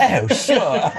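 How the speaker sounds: mock English accent while giggling; posh English accent. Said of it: "massacred the English dialect for y'all".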